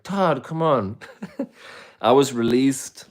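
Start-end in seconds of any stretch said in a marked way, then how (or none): 0.0-1.0 exasperated. Retold or imitated. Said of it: "I'm like".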